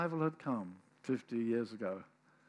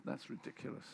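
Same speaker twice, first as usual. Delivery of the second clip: normal talking voice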